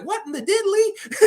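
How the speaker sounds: put-on very high kid voice, laughing; mock Flanders-style cussing. Little kid reacting to swearing, mock wholesome cussing. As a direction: imitate a wholesome kid's outburst through laughter. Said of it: "he was like Ned Flanders".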